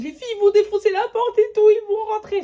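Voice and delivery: retold panicked alarm; panicked warning. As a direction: warn the girls in a panic. Alarming the sisters.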